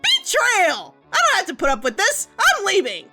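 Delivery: high-pitched indignant outburst; melodramatic outrage. Acted. Artist betrayed by friend to Doc, indignant outburst. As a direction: cry betrayal and storm off.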